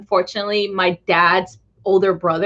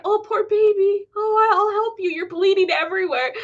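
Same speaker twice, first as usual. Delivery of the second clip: high sympathetic mom; 11 semitones higher than usual. Retold or imitated. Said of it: "Instead of, like, saying".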